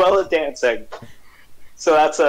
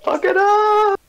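shouted cheer; slower than usual. Real, not acted. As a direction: yell it out to egg them on. Cheering the dance.

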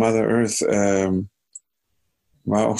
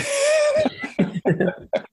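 high-pitched burst of laughter. Real, not acted. Amused by his own story of the improviser, laughing.

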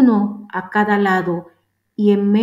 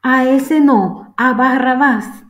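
higher, louder, slow crowd cry; crowd shouting; 4 semitones higher, 5 dB louder, slower than usual. A narrator's reading. Crowd rejecting Jesus before Pilate, slow loud shout. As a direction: call it out slowly like a crowd. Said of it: "Volvieron a gritar".

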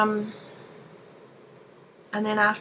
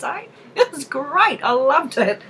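louder, through real laughter; laughing; 6 semitones higher, 6 dB louder than usual. Real, not acted. To the viewers about her crazy night, laughing. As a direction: gush it while still laughing.

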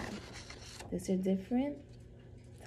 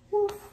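high, yappy woof woof; puppy barking; 13 semitones higher than usual. Acted. Biscuit the puppy barks at the vet, high and yappy. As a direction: yap like a small eager puppy.

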